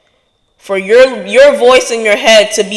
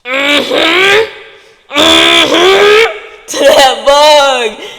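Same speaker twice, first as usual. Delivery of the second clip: stomp grunts; 6 semitones higher, 4 dB louder, much slower than usual. Acted. Imitating stomping the bug.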